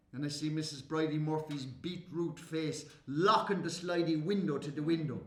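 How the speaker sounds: normal talking voice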